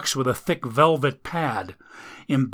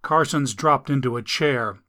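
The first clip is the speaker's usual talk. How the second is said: plain reading voice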